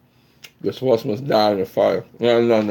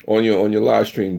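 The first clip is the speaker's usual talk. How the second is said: ordinary talk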